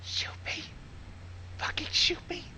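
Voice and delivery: breathy whisper of mock despair. Real, not acted. Listener begging to be shot, in a whisper. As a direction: whisper it like you want it over.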